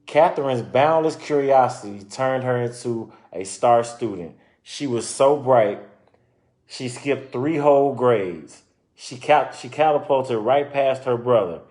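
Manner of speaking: lively storybook narration; reading to children. Acted. Reading a children's book aloud to listeners, lively storytelling. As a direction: read it out like a picture book for kids.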